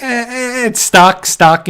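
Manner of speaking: mock whimper of a stuck child; panicking girl. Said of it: "like an eight-year-old would be".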